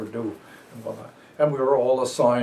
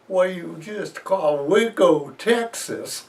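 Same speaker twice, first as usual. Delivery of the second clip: slow, drawn-out Southern drawl, pitched up; Southern accent; 7 semitones higher, 4 dB louder, slower than usual. Retold or imitated. Southern fireman drawling over the fire radio. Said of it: "with his, uh, Southern accent".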